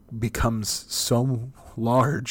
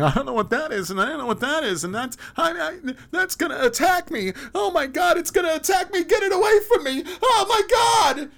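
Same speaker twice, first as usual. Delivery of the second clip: very high, loud screaming panic; terrified; 8 dB louder than usual. Acted. Lost adult in the wilderness, screaming in terror at everyday objects. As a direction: shriek that the thing will attack.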